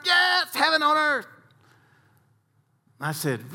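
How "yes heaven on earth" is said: much higher, gushing yes; squeaky, delighted agreement; eager chorus. Retold or imitated. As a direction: play the giddy golfers. Golfers answer in chorus.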